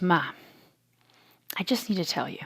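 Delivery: put-on whispered, confiding kid; son's soft voice. Son confiding to mom at bedtime, whispered. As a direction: breathe it out softly like a shy child. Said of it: "this is how he talks".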